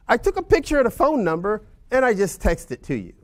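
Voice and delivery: mock client voice. Agent retelling the client's excuse.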